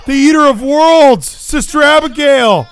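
hyped ringside call, high and loud; wrestling commentator. Real, not acted. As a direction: shout the move like a play-by-play caller. Live call of a wrestling match.

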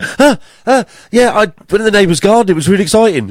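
high, eager dog voice; excited dog. Acted. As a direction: gush like an overexcited dog. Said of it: "Whereas a dog would be like".

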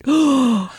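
a high, sharp, hammed-up gasp; mock gasp. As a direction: gasp in fake shock at your own confession. Mock shock at own celibacy.

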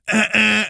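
two high honking noises; imitated car horns. Cars beeping at prank victim on motorway, imitated honks. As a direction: honk like passing cars. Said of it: "all the cars are going".

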